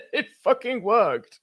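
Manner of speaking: high, laughing gloat; laughing triumph. Real, not acted. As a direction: crow about the win through laughter. Gloating over winning bet.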